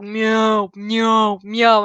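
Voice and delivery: a lightly voiced old cat meowing three times; a streamer imitating her old cat's meows in a meeting, mock meowing. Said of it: "Cat in the background be like".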